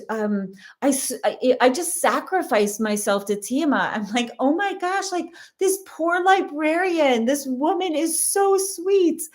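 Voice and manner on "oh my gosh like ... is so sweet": high-pitched, remorseful gush; retold pang of pity, pitched way up; gushing sympathy. Mother realizing she nearly snapped at a kind librarian, high gushing sympathy.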